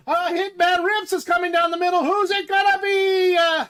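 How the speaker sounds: track announcer, building to a high-pitched finish; horse race commentary. Pretend. Host calling a horse race for members, building commentary. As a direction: call the race, climbing steadily in pitch and excitement.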